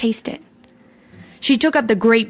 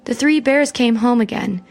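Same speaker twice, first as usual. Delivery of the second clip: usual speaking voice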